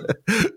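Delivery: high giggle at his own story; real, helpless chuckle; laugh. Real, not acted. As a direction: burst into a high laugh while telling it.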